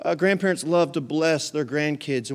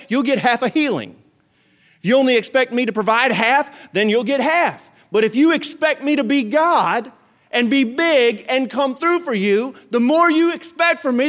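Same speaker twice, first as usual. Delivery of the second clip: God speaking; 6 semitones higher, 4 dB louder than usual. Acted. God's voice quoted in preaching.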